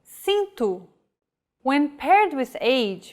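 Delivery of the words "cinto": cinto said with an s sound, SEEN-too; the C in cinto as a soft s; cinto, c before i as s; Portuguese soft c. Shown as a demonstration. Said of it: "or like this".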